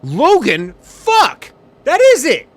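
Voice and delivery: loud, high-pitched outburst of alarm; alarmed shouting. Real, not acted. Pilot suddenly spotting his airport too late, alarmed shouting.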